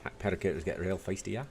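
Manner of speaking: put-on Irish accent with a Scottish lilt; attempted Dublin accent. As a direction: do a not-very-good Dubliner. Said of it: "Scottish lilt out of the attempt at an Irish accent".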